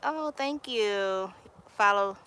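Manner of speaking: ordinary delivery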